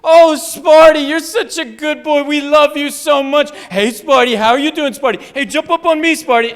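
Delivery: put-on very loud, high doggy baby talk; gushing pet talk. Shown as a demonstration. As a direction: coo excitedly at a beloved dog, at full volume. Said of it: "I'll show you what we do".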